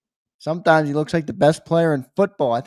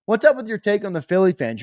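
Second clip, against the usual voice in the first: ordinary talk, nothing marked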